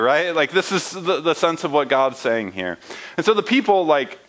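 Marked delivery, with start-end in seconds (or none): none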